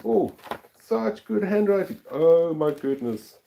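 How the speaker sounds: high, delighted admiration with a slow drawn-out exclamation; real delight at a gift; delighted gush. Real, not acted. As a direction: gush over the card.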